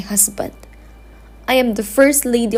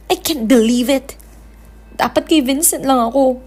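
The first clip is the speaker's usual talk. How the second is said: ordinary delivery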